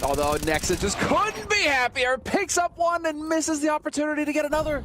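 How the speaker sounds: excited. Real, not acted. Caster hyping a big play.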